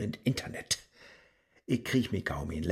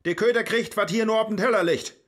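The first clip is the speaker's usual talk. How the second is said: loud, higher angry shouting; furious outburst; 7 semitones higher, 6 dB louder than usual. Acted. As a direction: snap at the useless box. Giving up, angry at device.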